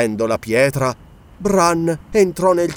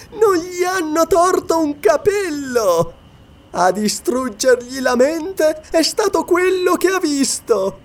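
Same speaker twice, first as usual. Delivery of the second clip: high-pitched gloating witch voice; taunting witch; 10 semitones higher than usual. A narrator's reading. Atla, the witch, taunts Bran. (voce acuta e beffarda da strega)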